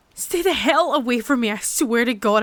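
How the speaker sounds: loud, desperate threat; frightened, angry yelling; furious shout. Acted. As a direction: yell to warn it off. Threatening the creature.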